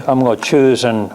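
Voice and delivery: usual speaking voice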